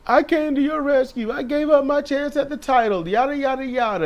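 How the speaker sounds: guilt-trip her in a high put-on whine; mock whiny Bianca. Mocking Bianca guilting her partner in a high whine.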